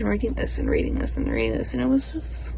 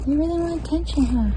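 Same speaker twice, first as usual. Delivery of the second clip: pet talk; 6 semitones higher, faster than usual. Real, not acted. Talking to her pet.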